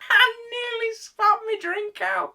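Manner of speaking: very high, shocked outburst; high-pitched astonished cry; incredulous squawk. Real, not acted. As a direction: shriek it in comic disbelief. Comic outrage at the film line.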